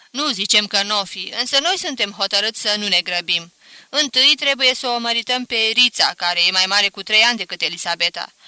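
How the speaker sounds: voiced father, decided and calm; father explaining they won't hurry; firm father. Father firmly declines.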